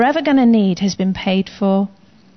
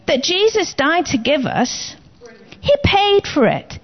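ordinary talk

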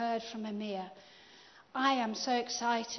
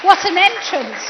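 loud, delighted cry of welcome; delighted exclamation; 7 semitones higher, 16 dB louder than usual. Real, not acted. Host greeting the guest's wife as she enters, delighted loud exclamation. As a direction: greet her grand entrance with delight.

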